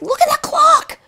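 high, urgent "look at that clock"; excited call. Retold or imitated. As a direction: point it out in a thrill. Team calling out in excitement.

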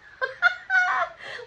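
high burst of real laughter; delighted belly laugh; big laugh. Real, not acted. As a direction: cracks up out loud. Reacting to a funny card.

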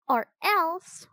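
put-on high-pitched defiant girl; defiant threat. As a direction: throw down a high little threat. Annie backs up Jack's threat.

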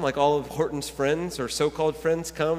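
normal talking voice

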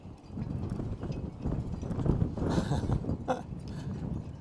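high, breathy fit of laughter; long giggling laugh at the gusty weather; long real laugh. Real, not acted. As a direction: laugh off the chaos of the wind. Laughing after the windy chaos.

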